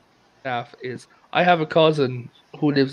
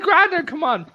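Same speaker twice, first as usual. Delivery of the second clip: put-on much higher, exasperated girl's voice; granddaughter's voice; 12 semitones higher than usual. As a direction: coax grandad along, high-pitched. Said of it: "He's like".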